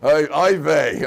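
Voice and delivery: drawn-out pained oi vey, slow and loud; slow loud oi vey groan. Retold or imitated. Congregation moaning slowly at the appeal for money. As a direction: moan it slowly like a reluctant donor. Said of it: "the whole shul goes".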